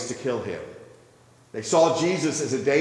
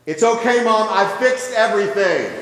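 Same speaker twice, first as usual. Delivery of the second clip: a lightly voiced little boy, loud and pleased; boy proudly telling his mom it's fixed, a touch higher; calm little boy; 7 semitones higher, 6 dB louder than usual. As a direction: reassure mom like a proud kid. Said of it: "very calmly and said".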